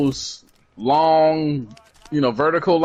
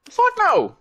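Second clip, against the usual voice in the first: high, emphatic "Fuck no"; emphatic denial. Real, not acted. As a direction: shoot the idea down hard. Emphatic reply to her question.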